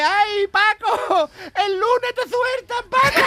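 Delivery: very high, slow, sobbing hammed-up wife; wailing wife. Wife sobs the punchline to Paco, high mock wail. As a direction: wail the punchline in a fake sob.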